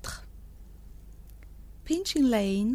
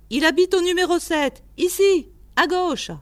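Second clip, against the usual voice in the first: quick, high, loud directions; animated old keeper; 7 semitones higher, 6 dB louder than usual. A narrator's reading. Keeper shows visitor where Toby lives, brisk and loud. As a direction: point the way briskly in a raised voice. Keeper points out the dog's place.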